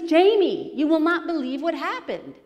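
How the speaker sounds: high and loud, bursting with news; excited blurt. Retold or imitated. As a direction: rush it out like an overexcited friend. Gabe rushing up like stampeding elephant.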